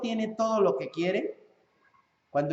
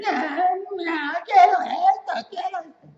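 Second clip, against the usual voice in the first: high, slow whining tantrum, loud on the first quiero; child's tantrum; 12 semitones higher, 8 dB louder, slower than usual. Retold or imitated. Preacher mimics a child throwing a tantrum, high and whiny. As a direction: wail like a spoiled kid throwing a fit. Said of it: "empieza a hacer sus pataletas".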